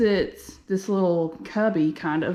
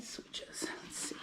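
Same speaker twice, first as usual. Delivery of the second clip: whispering; 16 dB quieter than usual. Real, not acted. Searching the dark bathroom.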